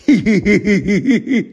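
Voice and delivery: високе гучне дрібне хихотіння; захихочи пронизливо, вибухами; єхидний регіт. Acted. Півз регоче. (лиходійське хихотіння)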